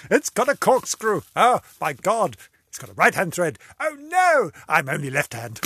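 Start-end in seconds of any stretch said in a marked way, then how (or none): none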